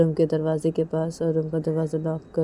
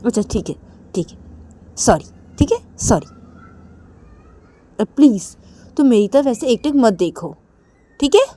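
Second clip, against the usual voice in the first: higher, louder sorry-please voice; higher pleading apology; apologetic plea; 6 semitones higher, 8 dB louder than usual. Acted. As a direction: give in and plead.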